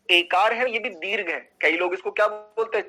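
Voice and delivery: ordinary talk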